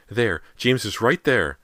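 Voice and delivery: furious yell. A narrator's reading. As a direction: point and yell it. Mother pointing furiously.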